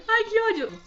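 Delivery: high, loud outburst through real tears; cross with oneself for crying; tearful frustration. Real, not acted. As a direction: voice jumping up, choked with emotion. Tears return, annoyed at herself.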